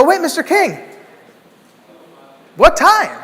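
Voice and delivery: high eager shout-question; urgent call. Retold or imitated. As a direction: call out before the door shuts. Calling after him.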